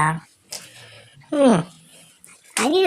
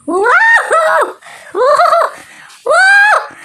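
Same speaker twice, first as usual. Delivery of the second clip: very high, loud gleeful whoops; excited whoop; 5 dB louder, much slower than usual. Acted. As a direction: cheer like a wild cartoon bird. Cartoon bird cheering loudly.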